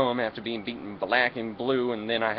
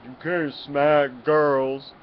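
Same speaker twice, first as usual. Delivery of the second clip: loud, drawn-out cop scolding; slow, loud voice of authority; police officer; 2 semitones higher, 6 dB louder, much slower than usual. Acted. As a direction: play an officer laying down the law, slowly. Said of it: "He came over and said".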